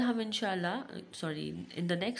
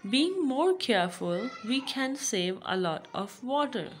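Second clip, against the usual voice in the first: raised, projected classroom reading; read-aloud lesson; 5 semitones higher, 7 dB louder than usual. Acted. Teacher reading the last point to pupils, read-aloud.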